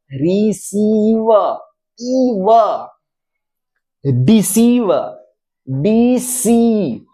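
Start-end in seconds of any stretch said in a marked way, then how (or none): 0.1-1.3 रिसीव with the सी held long and slow; long ee in receive. Shown as a demonstration. Teacher stretching the long ee of रिसीव. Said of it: "उसको दीर्घ बनाने के लिए". 5.7-7.0 डीसी with the ee drawn out very slowly; long ee held. Shown as a demonstration. Teacher holding the ee sound in deceive. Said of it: "उसको दीर्घ बनाने के लिए".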